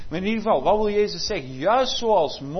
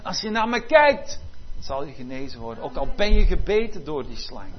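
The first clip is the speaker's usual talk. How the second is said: loud preaching; 5 dB louder than usual. Acted. Jesus quoted, preached loudly.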